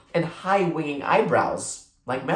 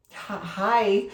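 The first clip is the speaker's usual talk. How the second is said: a higher, halting stammer after the first sight; stunned stammer; 6 semitones higher than usual. A narrator's reading. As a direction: caught off guard, she can barely get the greeting out. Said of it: "Melanie managed after that first speechless moment".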